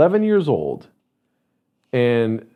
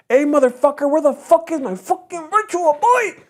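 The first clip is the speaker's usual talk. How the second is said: very high, irate mock shout; hot-headed yell; 14 semitones higher than usual. Shown as a demonstration. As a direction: imitate a furious cousin demanding it back. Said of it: "He shouldn't have yelled".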